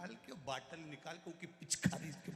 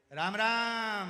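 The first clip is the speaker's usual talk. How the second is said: much louder, higher drawn-out greeting; 7 semitones higher, 12 dB louder, much slower than usual. Retold or imitated. Deaf grandfather shouts a greeting to sick friend. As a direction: bellow it across the ward. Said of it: "चिल्ला के बोलता है".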